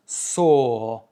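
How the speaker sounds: SORE drawn out on one long O. Shown as a demonstration. Teacher shows how sore is pronounced, long-O demo. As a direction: stretch the vowel of SORE slowly, "sooo". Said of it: "avec juste un O long".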